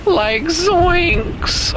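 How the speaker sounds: high cracking cartoon voice; Shaggy voice. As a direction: squeak it like Shaggy. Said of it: "what is my voice crack doing?".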